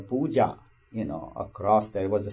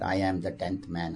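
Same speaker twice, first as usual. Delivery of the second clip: lightly hushed, inward repetition; soft mantra; 4 semitones lower, 7 dB quieter than usual. Retold or imitated. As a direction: murmur it as in meditation. Retold morning meditation mantra.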